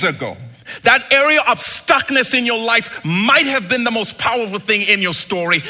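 loud and high, driving the point home; preaching at full force. Acted. Pastor urging listeners about their stuckness, full-force preaching. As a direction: build the church up to a roar.

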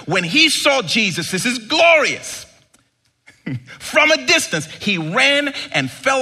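loud, high, fervent sermon climax; preaching at full force. Acted. Pastor to his church on Christmas, full-force preaching. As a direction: lift the whole room with it.

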